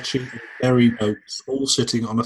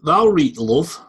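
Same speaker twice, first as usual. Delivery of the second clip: mock Welsh accent, louder; 4 semitones higher, 7 dB louder than usual. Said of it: "best Welsh accent I've heard".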